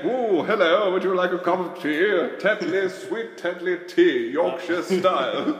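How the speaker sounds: lightly regal tea-ad voice, slow at the end; a hint of a stately tea commercial; posh tea advert. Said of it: "nobody wants me to say".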